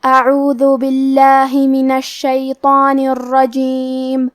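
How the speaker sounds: slow, melodic tajweed chanting; Quran recitation. Shown as a demonstration. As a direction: draw out each vowel in recitation.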